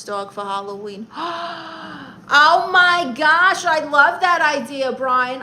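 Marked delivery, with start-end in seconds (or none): 1.1-2.2 big high gasp of excitement; sharp intake of excited surprise; delighted gasp. Real, not acted. Streamer hearing a Halloween costume idea, excited gasp. 2.3-5.4 loud, high, drawn-out enthusiasm; excited delight. Real, not acted. Streamer loving a viewer's Halloween idea, loud excited gushing.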